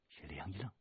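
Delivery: plain reading voice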